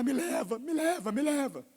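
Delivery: hammed-up grieving widow; mock wailing widow. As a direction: imitate the wailing widow lightly. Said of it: "Só voltava a gritar".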